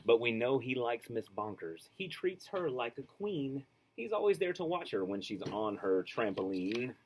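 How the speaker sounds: put-on lively, sing-song reading to children; storybook read-aloud. A narrator's reading. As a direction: read it like a bedtime picture book. Reads picture book aloud.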